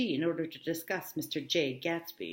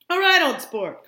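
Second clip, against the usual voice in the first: calling out; 10 semitones higher, 13 dB louder than usual. Acted. Gatsby calls out to policeman.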